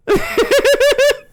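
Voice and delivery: high-pitched burst of laughter. Real, not acted. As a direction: cackle with amusement. Laughing after mocking.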